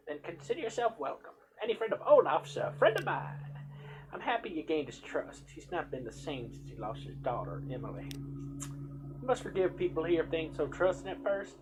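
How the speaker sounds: a hint of a kindly village healer warning the hero; healer woman's voice.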